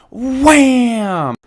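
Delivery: loud, high crash; wham. A narrator's reading. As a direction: hit the bang word hard. Crash sound word.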